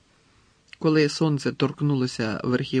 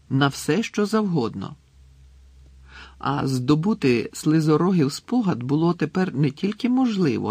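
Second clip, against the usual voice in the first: ordinary delivery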